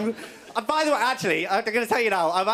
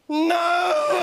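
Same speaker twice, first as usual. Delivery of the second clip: high, loud yelped "No!"; shouted no; 8 semitones higher, 3 dB louder than usual. Retold or imitated. Tiny man blocking a punch, high loud yelp. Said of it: "He just went".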